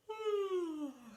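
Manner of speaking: big sleepy yawn; yawning sigh. Shown as a demonstration. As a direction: stretch out a yawn. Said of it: "Can you yawn?".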